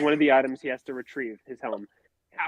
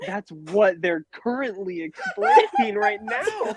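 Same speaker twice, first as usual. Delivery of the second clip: higher, slow, laughing protest; exasperated; 6 semitones higher, slower than usual. Retold or imitated. To her as the film explains it, exasperated. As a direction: spell it out in mock exasperation, pitched up. Exasperated reply to confused movie companion.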